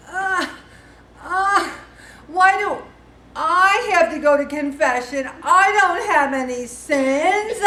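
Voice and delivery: loud, high-pitched protest; exasperated, drawn-out teen complaint; indignant teenager. Retold or imitated. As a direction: whine loudly like a put-upon high schooler. Acting out an indignant high schooler.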